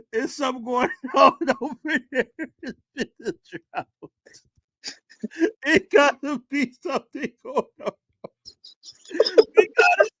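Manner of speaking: high-pitched words squeezed out through helpless laughter; laughing till crying. Real, not acted. Host cracking up at a friend's joke, laughing until crying. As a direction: try to talk while cracking up so hard tears come. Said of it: "Lab, stop crying".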